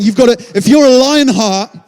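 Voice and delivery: loud, high, insistent command; emphatic challenge from God; urgent voice of God. Pretend. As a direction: push it home, slowing on change. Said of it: "He's saying".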